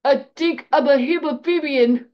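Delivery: loud, high character yell. A narrator's reading. Student calling out his ailment to the professor, yelling. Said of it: "he yelled".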